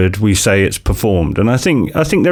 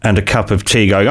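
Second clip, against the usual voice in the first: ordinary talk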